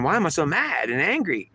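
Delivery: high, anguished demand; acted outburst of anger, pitch leaping up; mad and angry. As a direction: wail the question in protest. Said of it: "And she's saying".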